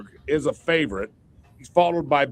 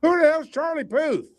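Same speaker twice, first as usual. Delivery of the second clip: high-pitched, baffled outburst; incredulous question; 11 semitones higher than usual. Real, not acted. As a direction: ask it in high-voiced disbelief. Baffled by an unknown singer's name.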